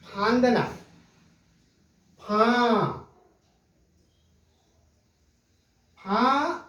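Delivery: फां with the आं ringing through mouth and nose; nasal vowel demo. Shown as a demonstration. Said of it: "जब हम बोल रहे हैं तो आवाज निकल रही है फां".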